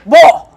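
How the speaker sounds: "Bo." said loud and short; Korean word bo. Retold or imitated. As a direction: snap out "Bo." as a command. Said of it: "try to say it in this stupid Korean accent".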